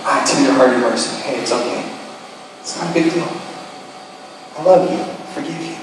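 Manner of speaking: soft and tender; quietly forgive them; gentle forgiving. Pretend. Voicing forgiving response to a wrong.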